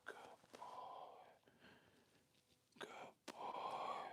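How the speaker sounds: soft whispered praise, drawn out; hushed praise. Real, not acted. Trainer praises Jax balancing on the back, slow soft whisper. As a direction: whisper it soothingly to a dog. Soothing the dog during the trick.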